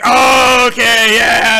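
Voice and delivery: high, loud excited cheer; excited shout. Acted. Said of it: "And they're like".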